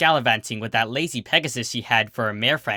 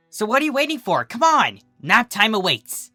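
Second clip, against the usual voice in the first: high, louder eager calling up; excited call; 10 semitones higher, 6 dB louder than usual. Acted. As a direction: urge her on, bursting with excitement. Calling her girlfriend up the tree.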